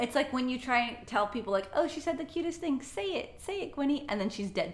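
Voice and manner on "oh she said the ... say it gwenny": coaxing parent. Retold or imitated. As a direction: gently urge a child to speak. Parent coaxing child to speak.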